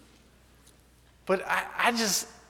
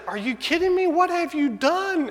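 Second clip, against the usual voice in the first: much higher, slowed, disbelieving; alarmed wife; 13 semitones higher, 5 dB louder than usual. Retold or imitated. Wife to husband over the strange dog, high alarmed disbelief. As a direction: play the panicked wife pitched way up.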